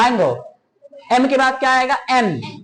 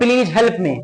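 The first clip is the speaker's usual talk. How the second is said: the usual Indian way of saying it; Indian English; 3 semitones lower, much slower than usual. Shown as a demonstration. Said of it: "और हम लोग".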